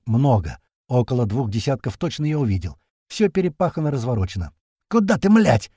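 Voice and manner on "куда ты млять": angry yell. A narrator's reading. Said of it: "внезапно заорал маленький".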